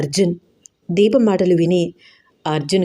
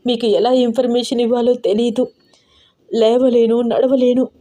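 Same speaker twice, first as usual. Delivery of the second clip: sobbing; 4 semitones higher than usual. Acted. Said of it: "వెక్కి వెక్కి ఏడుస్తూ అంది దీప".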